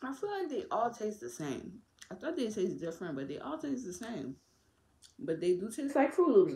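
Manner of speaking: talking while chewing candy; words slightly muffled by food; mouth full, chewing. Real, not acted. Seen 4: Gummy put in mouth at -5; jaw working while talking.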